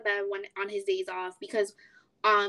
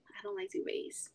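a quick whisper, almost under breath; whispering her dislike of duvets; 12 dB quieter than usual. Real, not acted. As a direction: drop to a whisper like a confession. Quiet confession about duvets.

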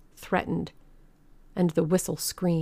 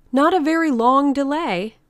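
raised, high placating voice; placating organizer; 8 semitones higher, 6 dB louder than usual. A narrator's reading. Mister Thurkell pacifies the protesting ladies, high and loud. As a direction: call it out high over the protests.

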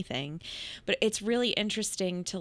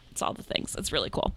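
usual speaking voice

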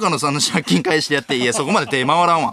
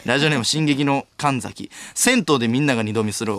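normal talking voice

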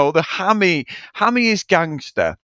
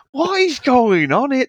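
baffled disbelief; 9 semitones higher than usual. Retold or imitated. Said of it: "I'm like".

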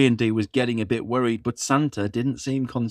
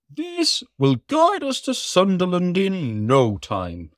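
Santa voice; 7 semitones higher than usual. Acted. Santa speaks.

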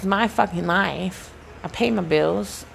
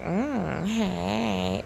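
flirty; 5 dB quieter than usual. Shown as a demonstration. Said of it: "like, flirting".

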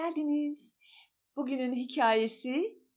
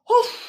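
a big loud weary sigh, high; 11 semitones higher, 11 dB louder than usual. Acted. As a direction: heave a sad dog's sigh. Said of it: "diye bir iç çekmiş". (yüksek sesli derin iç çekiş)

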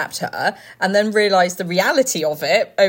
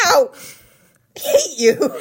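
high, louder pained yelp; mock yelp; 5 semitones higher, 4 dB louder, much slower than usual. Handkerchief ripped off his bum, a mock pained yelp. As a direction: yelp then snap at him.

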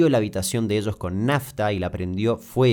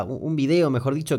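ordinary talk, nothing marked

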